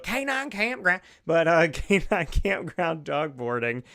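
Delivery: put-on high, loud, playful country accent on the sponsor name. Radio host reading sponsors, hammy country accent. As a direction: slip into a hammed-up country accent, laughing. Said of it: "going in and out of country accent".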